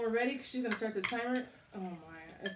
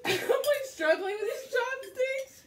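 high, laughing-crying voice; laugh-cry through the struggle; snotty crying; 8 dB louder, slower than usual. Real, not acted. Struggling with spicy food.

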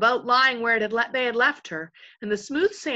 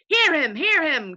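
chorus cry; 8 semitones higher, 7 dB louder than usual. A narrator's reading. Followers backing their invisible chief, crying out. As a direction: cry out in hearty support. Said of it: "cried the chorus".

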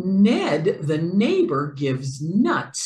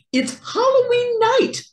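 excited; 4 dB louder, faster than usual. A narrator's reading. Dramatic picture-book opening.

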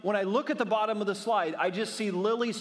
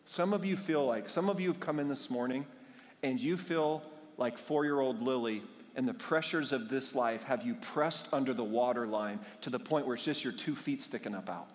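ordinary talk

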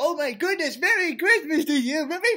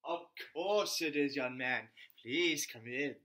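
much lower, softer fatherly voice; deep put-on voice; 13 semitones lower, 10 dB quieter than usual. Host greets the new boy in deep voice. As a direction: drop way down like a big daddy.

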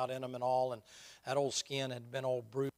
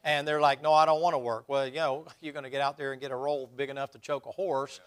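loud, emphatic sermon delivery with raised pitch; forceful preaching; 2 semitones higher, 10 dB louder than usual. Real, not acted. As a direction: drive the point home to the congregation.

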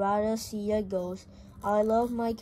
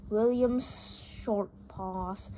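plain reading voice

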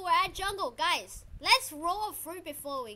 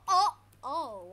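usual speaking voice

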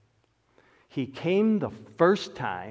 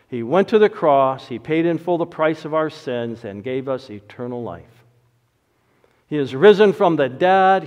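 earnest, louder pastoral prayer; closing prayer; 2 semitones higher, 9 dB louder than usual. Acted. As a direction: pray it aloud for the congregation.

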